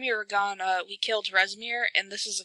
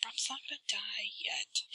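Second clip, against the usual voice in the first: whispering; 15 dB quieter than usual. Real, not acted. Aside to another player.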